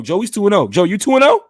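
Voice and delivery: loud hype chant; hype shout. Acted. Hyping a repeated catchphrase.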